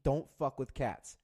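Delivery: normal talking voice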